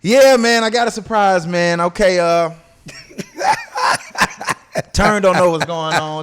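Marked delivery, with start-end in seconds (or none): 2.8-4.9 high breathless giggle. Real, not acted. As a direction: giggle helplessly, almost silent. Laughs during podcast banter.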